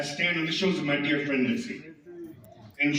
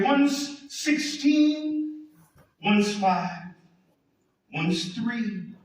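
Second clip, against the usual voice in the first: higher, sustained chant-like delivery with dramatic pauses; poetry recitation; 10 semitones higher, slower than usual. Acted. Reading a Hughes poem as a prayer for Memphis, poetry recitation.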